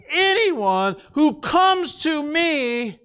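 ANYONE held high and long, slow and insistent; emphatic. Retold or imitated. Preacher voicing Jesus's promise to all, emphatic. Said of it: "he is saying it emphatically".

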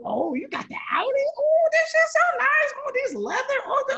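put-on very high, gushing excited girl voice; car noises. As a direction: swoon over the leather seats. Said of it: "You know how chicks do when they gas in the door".

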